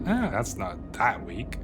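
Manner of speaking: ordinary talk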